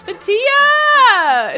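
high, loud drawn-out shout; excited call. Real, not acted. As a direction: holler a friend's name in delight. Spots a favorite character, calls out.

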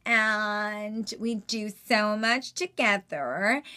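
slow, drawn-out American; slow American valley drawl; Kourtney drawl. Shown as a demonstration. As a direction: stretch every word like Kourtney. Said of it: "Or then you've got Kourtney".

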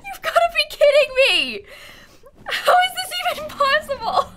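high-pitched, half-laughing disbelief; high incredulous wail. Real, not acted. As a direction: wail it, sliding down at the end. Reacting to absurd death.

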